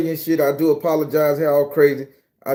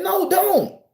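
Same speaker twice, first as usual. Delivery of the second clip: high-pitched mock pleading; alarmed refusal; 13 semitones higher than usual. Exerciser warding off approaching woman, high mock-alarmed refusal. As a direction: plead her away in a very high retold voice. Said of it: "I'm literally saying".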